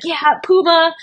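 high cheer for the sponsor. Retold or imitated. Runner celebrating her teammate's sponsor, cheering. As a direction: shout it out like a fan. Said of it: "so being like".

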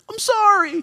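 very high, squeaky sorry; mock apology. Refusing to cower before gangbangers, squeaky mock apology. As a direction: squeak it like a scared pushover.

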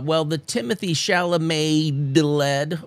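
ordinary talk, nothing marked

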